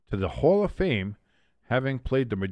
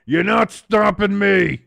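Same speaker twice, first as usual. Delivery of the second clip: tough running back; 8 semitones higher, 9 dB louder, slower than usual. Retold or imitated. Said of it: "powered through and said".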